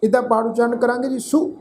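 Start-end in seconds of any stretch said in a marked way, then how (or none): 1.2-1.5 ਸੋ said as a short, higher "su". Shown as a demonstration. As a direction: model ਸੋ with a brief "u" vowel. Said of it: "ਸੱਸੇ ਅੱਖਰ ਦੇ ਪੈਰ 'ਚ ਔਂਕੜ".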